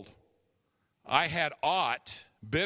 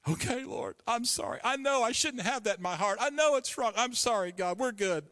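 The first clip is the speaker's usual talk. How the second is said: pleading; 7 semitones higher than usual. Retold or imitated. Pleading with God.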